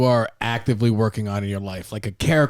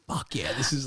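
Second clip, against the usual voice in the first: whispered aside, imitating himself listening in; quiet, whispery retold cheer; hushed admiration; 10 dB quieter than usual. Retelling his overheard-singing reaction.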